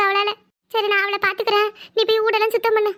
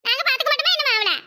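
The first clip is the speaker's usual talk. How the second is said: indignant scolding; 5 semitones higher than usual. Acted. Mother-in-law scolds son for leaving.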